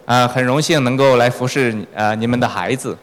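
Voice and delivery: ordinary talk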